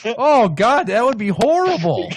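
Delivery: high, loud, drawn-out revulsion; amused horror. Real, not acted. Friend repeating how awful it is, loud horrified wail. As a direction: cry out at a dreadful thought. Appalled by the image.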